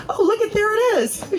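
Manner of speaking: a laughing, high-pitched little eureka; delighted discovery. Retold or imitated. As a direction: squeal it like you just found it. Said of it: "was like".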